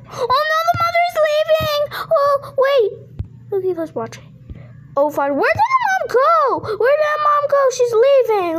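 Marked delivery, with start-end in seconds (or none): 0.1-2.9 high-pitched mock-tearful alarm; dramatic alarm. Reacts to mother leaving in story. 5.0-8.6 high, wailing cry of alarm; hammed-up tearful distress; play-acted panic. Panics over mother leaving in story.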